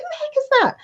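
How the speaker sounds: jumps way up high, puzzled; who on earth sent this, baffled; baffled surprise. Retold or imitated. Reading a card from a stranger, high baffled surprise.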